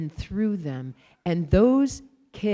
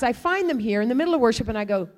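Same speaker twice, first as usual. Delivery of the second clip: ordinary talk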